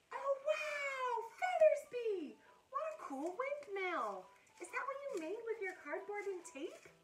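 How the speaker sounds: wide-eyed excitement for a puppet friend; delighted gushing. Acted. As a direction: marvel at the child's cardboard windmill. Amazed at puppet's windmill.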